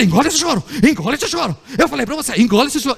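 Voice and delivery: lightly acted stern dad ordering a child; scolding father. Father to a crying boy, mock-stern scolding. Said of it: "Esse é o pai".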